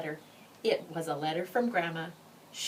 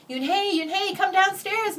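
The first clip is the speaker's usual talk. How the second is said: higher, louder call up the stairs; calling upstairs; 13 semitones higher, 7 dB louder than usual. Acted. Mother calling her daughter downstairs, loud call. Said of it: "Mother called up to Yoonhae".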